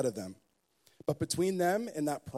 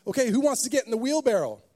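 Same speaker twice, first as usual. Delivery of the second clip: showman challenge; 7 semitones higher, 8 dB louder, faster than usual. Retold or imitated.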